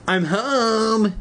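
high-pitched calling out; shout. A narrator's reading. Conan arriving home, shouting to the household. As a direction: sing out as if shouting through the house. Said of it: "he heard Conan shout".

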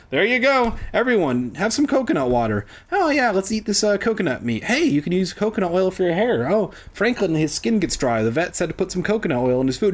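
lightly hammed-up sarcastic role-play; mock breezy chatter. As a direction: prattle on as if it were easy. Mocks castaways casually sharing coconut.

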